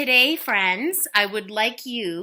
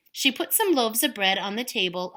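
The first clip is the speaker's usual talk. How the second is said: plain reading voice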